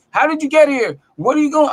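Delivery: high, loud imitation of a yelling security guard; mock guard yelling. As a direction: ham up the angry guard barking at you. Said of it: "he's just yelling at me".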